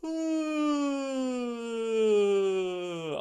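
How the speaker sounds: big loud yawn sliding down from high; rewarding yawn. Shown as a demonstration. Instructor showing the class an enthusiastic yawn and stretch. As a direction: stretch out and yawn like you mean it. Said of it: "a really rewarding yawn and a stretch".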